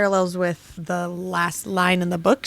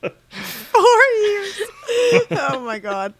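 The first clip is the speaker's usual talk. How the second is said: laughing; 10 semitones higher, much slower than usual. Real, not acted. Echoing with tearful laugh.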